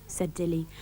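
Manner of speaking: usual speaking voice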